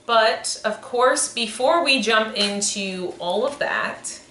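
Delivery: ordinary talk, nothing marked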